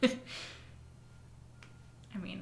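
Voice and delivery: normal talking voice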